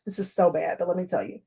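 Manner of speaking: ordinary delivery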